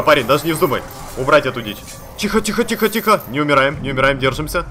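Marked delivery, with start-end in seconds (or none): 2.2-3.2 high, urgent repeated plea; pleading for calm in a high-pitched rush; panicked hushing. Real, not acted. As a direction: jump up high, repeating it in a flurry. Under attack, nearly dying.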